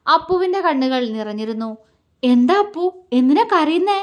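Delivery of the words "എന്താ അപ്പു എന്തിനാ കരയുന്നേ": higher, alarmed questioning; flustered, anxious. A narrator's reading. Husband finding his bride in tears, flustered and concerned. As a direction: ask in a worried fluster. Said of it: "അവൻ വെപ്രാളത്തോടെ ചോദിച്ചു".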